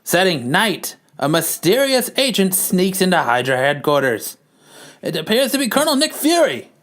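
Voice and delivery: put-on high-pitched old-time narrator reading the scene; old-timey narration. Narrates the comic in a voice.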